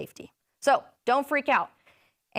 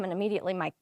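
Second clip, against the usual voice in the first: normal talking voice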